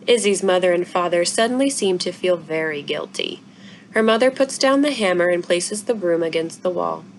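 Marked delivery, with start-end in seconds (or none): none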